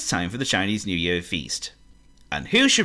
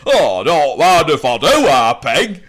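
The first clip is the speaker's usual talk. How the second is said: greedy pig voice; 6 semitones higher, 9 dB louder, slower than usual. Acted.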